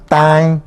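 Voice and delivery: "tai" with the A sent through the nose; "tai" with a held nasal vowel. Shown as a demonstration. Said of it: "La A es nasal".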